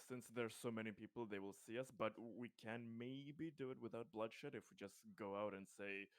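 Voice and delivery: ordinary delivery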